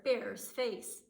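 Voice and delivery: ordinary delivery, nothing marked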